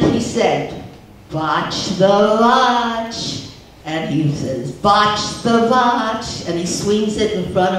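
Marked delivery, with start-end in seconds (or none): 1.3-3.5 slow, drawn-out Yiddish-accented "Watch the watch" with a V sound, "vatch". Shown as a demonstration. Husband hypnotizing his wife, slow Yiddish accent. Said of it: "with the accent". 4.8-6.5 slow, drawn-out Yiddish-accented "Watch the watch" with a V sound, "vatch". Shown as a demonstration. Husband hypnotizing his wife, slow Yiddish accent. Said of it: "with the accent".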